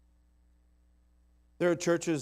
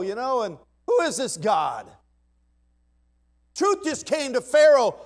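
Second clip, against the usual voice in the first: high, loud, slow preacher's shout; shouted preaching; 9 semitones higher, 12 dB louder, slower than usual. Acted. Preacher to the congregation, slow shouted preaching. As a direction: hammer it home to the pews.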